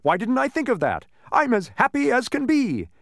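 high, loud, elated exclamation; happy shout. A narrator's reading. Man learning his name, happy shout. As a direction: crow with overjoyed high energy. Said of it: "the man shouted".

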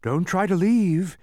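high, loud, slow command; menacing order. A narrator's reading. The Trivium stopping his visitors from leaving, menacing order. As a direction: order them to stay, with menace. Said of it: "he ordered, with a menacing sweep".

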